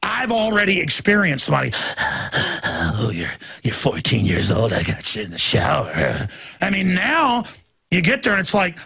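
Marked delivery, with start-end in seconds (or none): 2.0-6.3 much lower, leering mock voice of a creepy guard. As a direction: drop low and leer like a predator mocking a boy. Imitating a predatory guard.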